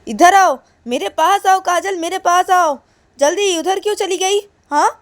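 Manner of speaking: very high, loud angry yelling; shouting mother. Acted. Mother yelling at daughter who ran off, very high and loud. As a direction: yell at her to come back now. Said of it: "काजल की mummy बोलती".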